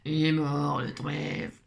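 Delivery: low, drawn-out mock lament; mock mourning. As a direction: mourn the clover in a hammed-up low voice. Pleure pour rire le trèfle. (lamentation grave, pour rire)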